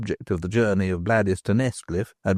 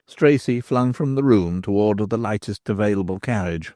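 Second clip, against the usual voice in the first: ordinary delivery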